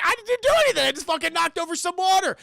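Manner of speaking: high, loud mock-panicked denial; defensive protest. Accused boy protests innocence.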